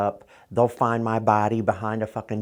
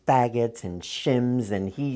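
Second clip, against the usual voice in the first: ordinary talk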